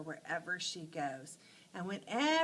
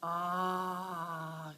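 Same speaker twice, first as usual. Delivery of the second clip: relaxed ah; 3 semitones lower than usual. Shown as a demonstration. Teacher showing a bathtub sigh of comfort. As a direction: sink into warm water. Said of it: "you go".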